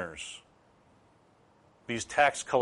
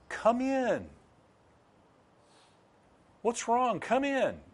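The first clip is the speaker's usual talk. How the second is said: high, gentle pleading father's voice; 13 semitones higher, slower than usual. Acted. Father gently begging his angry son to come in. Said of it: "entreats his son to join in".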